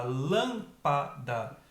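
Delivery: LÂMPADA broken slowly into three separate syllables; pausada, sílaba por sílaba. Shown as a demonstration. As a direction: say lâmpada very slowly, one puff of air per syllable. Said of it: "Você faz pausadamente a pronúncia". (lâmpada pausadamente, separando as três sílabas)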